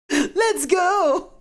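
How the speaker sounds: very high, loud shout; squealed anime battle call; urgent call. Acted. Character calls out to flee.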